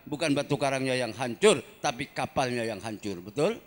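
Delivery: loud rhythmic sermon cadence; fiery preacher calling out to the crowd; preaching. Acted. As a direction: drive each line home to the congregation.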